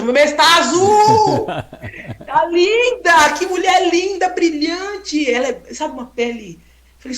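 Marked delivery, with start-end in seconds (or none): 0.0-5.3 high, slow, laughing insistence; overflowing admiration; excited gushing. Retold or imitated. Insisting to the old lady she is beautiful, high laughing gush.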